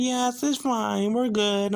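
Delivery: high, drawn-out mock all-clear; feigned reassurance. Patient covering up to the nurse, falsely reassuring.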